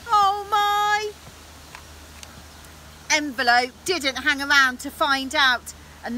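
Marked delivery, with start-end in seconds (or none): 0.1-1.1 dismayed exclamation. A narrator's reading. Alarm as dragon faces the knight.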